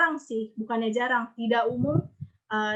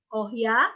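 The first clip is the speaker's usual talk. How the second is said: "Oh ya" ending in an upward sweep; questioning rise. Shown as a demonstration. Teacher likening tone two to a question, rising tune. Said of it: "kayak gitu nadanya".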